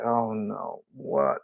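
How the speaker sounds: soft stunned oh no; dismayed murmur. Retold or imitated. Drummer realising he missed, softly stunned. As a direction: let it drop out quietly. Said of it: "I'm like".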